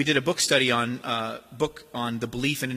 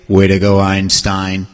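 low, loud sarcastic praise; mock someone with fake congratulations; deep booming sarcastic put-down; ironic; 8 semitones lower, 11 dB louder, slower than usual. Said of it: "It's ironic".

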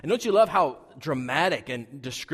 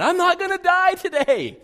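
much higher and louder burst of relief; relieved joy; 10 dB louder than usual. Retold or imitated. Boy saved from the rattlesnake, crying out in high, loud relief. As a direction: shout it out like a rescued kid. Relief after snake is shot.

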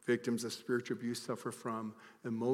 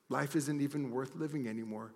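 normal talking voice

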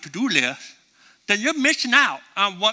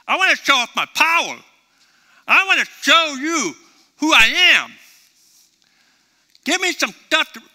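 raised, louder voice of God eager to show his power; God pushing his people to pray big, higher and louder; God urging boldly; 7 semitones higher, 4 dB louder than usual. Retold or imitated. Preacher voicing God calling for bold prayers, raised and eager. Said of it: "And God's like".

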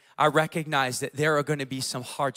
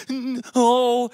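higher, louder tearful child imitation; hammed-up child's cry; mock crying; 6 semitones higher, 5 dB louder than usual. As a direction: wail it like an upset little girl. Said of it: "doing that, that deep cry thing".

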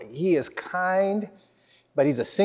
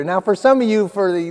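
ordinary talk, nothing marked

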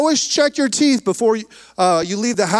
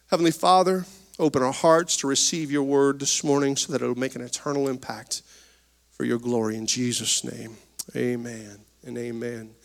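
low, soft, reverent prayer voice; earnest prayer; 9 semitones lower, 4 dB quieter than usual. Acted. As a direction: pray quietly to open the service. Pastor prays before the sermon.

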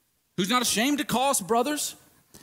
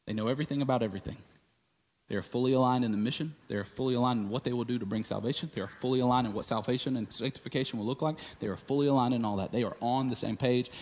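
rapid low listing; 9 semitones lower, 5 dB quieter, faster than usual. Acted.

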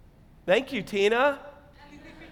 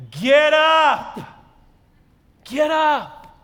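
loud, high ringing command; commanding call; 6 semitones higher, 5 dB louder, much slower than usual. Acted. Angel waking Elijah, loud and commanding. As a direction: call it out to wake the sleeper.

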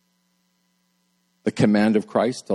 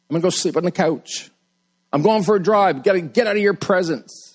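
petulant spouse; 3 semitones higher than usual. Retold or imitated. Acting out spouse tantrum.

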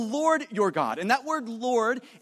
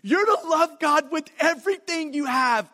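higher, forceful push on "everything you have"; great emphasis; 6 semitones higher, 4 dB louder than usual. Retold or imitated. Preacher saying it as God would, with great emphasis. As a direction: drive "love God with everything you have" home, pitched up. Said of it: "with great emphasis".

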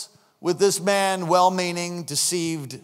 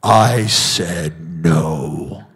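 deep, very slow and low voice of the Lord; grave divine command; voice of God; 4 dB louder, much slower than usual. Retold or imitated. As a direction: speak slowly from far down as God. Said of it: "and the Lord said".

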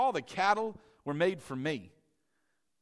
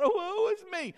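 mock whine; 13 semitones higher than usual. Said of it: "start whining".

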